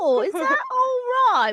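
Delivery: put-on British accent. Flirting over a kink for British accents, British accent. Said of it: "My kink is British accents".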